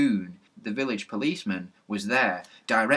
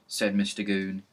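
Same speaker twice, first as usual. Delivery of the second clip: usual speaking voice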